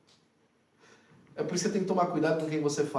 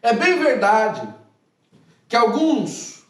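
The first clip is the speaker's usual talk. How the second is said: emphatic; 4 semitones higher, 6 dB louder than usual. Retold or imitated. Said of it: "ele usa uma expressão".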